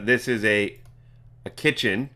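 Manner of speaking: normal talking voice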